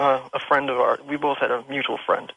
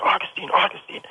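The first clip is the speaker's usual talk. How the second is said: urgent whisper. Retold or imitated. Waking friend, scared of ghost.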